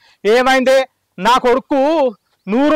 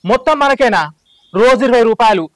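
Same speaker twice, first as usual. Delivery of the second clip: ordinary delivery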